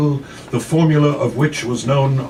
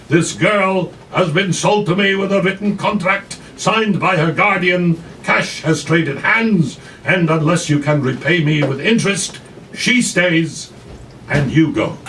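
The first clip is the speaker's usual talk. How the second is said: angry madam; 6 semitones higher, 5 dB louder than usual. Retold or imitated. Madam tells the grandmother she stays, loud and high. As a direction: lay down the law. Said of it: "shouted the madam".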